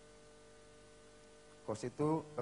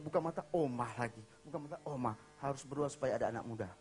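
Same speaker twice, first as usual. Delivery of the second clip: ordinary talk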